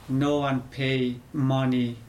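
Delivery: ordinary talk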